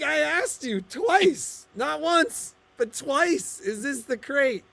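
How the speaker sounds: high, slow, drawn-out protest; exasperated. Retold or imitated. Husband to wife in the car about the crate, slow exasperated whine. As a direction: lay out each word like an exasperated husband.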